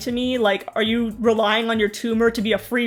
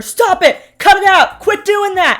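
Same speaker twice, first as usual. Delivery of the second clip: higher, louder mock yell at a toddler; a hammed-up shouting dad; imitating a yelling dad; 8 semitones higher, 6 dB louder than usual. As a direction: bark the orders like a fed-up parent. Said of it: "He's yelling at her".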